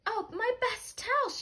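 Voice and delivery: high, dismayed wail over the towels; dismayed lady. Acted. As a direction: voice her like a shocked housewife. Mrs Rogers discovers ruined towels.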